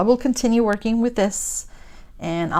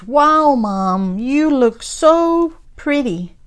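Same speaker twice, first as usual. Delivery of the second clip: high, loud, drawn-out wonder; awestruck little boy; 6 semitones higher, 7 dB louder, much slower than usual. Retold or imitated. Little boy seeing his mom in her wedding dress, awestruck. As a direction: imitate a boy gushing loudly, stretching the words. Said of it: "and the little boy says".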